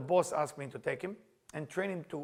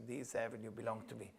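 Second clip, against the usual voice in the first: softer, lower echo of the trainer; subdued repeat; 5 semitones lower, 10 dB quieter than usual. Retold or imitated. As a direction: repeat it back quietly as the reluctant trainee. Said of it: "please repeat after me".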